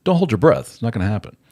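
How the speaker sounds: mock defiant comeback; defiant retort. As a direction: snap back at her, fed up. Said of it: "You're like".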